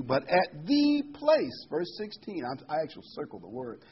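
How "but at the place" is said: "but at the place" slow, higher and louder; stress on "the place". Shown as a demonstration. Preacher stressing the chosen place, slow emphatic. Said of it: "so I would emphasize".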